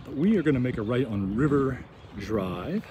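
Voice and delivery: ordinary talk, nothing marked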